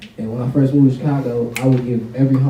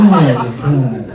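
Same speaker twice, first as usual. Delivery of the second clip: usual speaking voice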